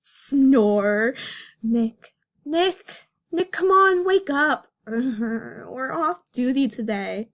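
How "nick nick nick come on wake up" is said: higher, pushy wake-up call to Nick; bunny cop shaking her partner awake; voicing Judy. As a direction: nudge him awake, getting louder.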